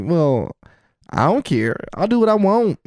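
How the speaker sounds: carefree defiance, rising high at the end; reassured he can eat anything, cocky and defiant. Retold or imitated. Defiant inner voice.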